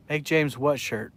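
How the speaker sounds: usual speaking voice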